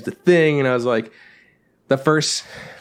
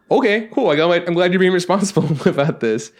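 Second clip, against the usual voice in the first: laughing sarcasm; 6 semitones higher than usual. Retold or imitated. Laughing at dad's order.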